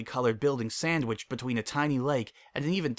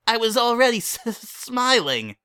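put-on high kid squeal through giggles; wheezing laugh; 10 semitones higher, 7 dB louder than usual. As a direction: sputter it out between wheezes. Child laughing.

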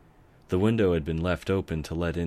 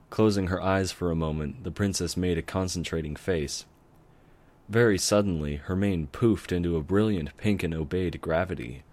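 usual speaking voice

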